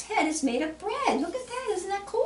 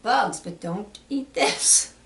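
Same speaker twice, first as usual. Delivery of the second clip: ordinary delivery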